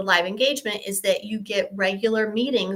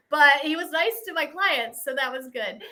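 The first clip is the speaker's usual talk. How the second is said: usual speaking voice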